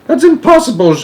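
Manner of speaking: very high, loud protest; desperate cry. A narrator's reading. Astronaut refusing the president's order, crying out. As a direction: cry out that it can't be done. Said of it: "cried Showler".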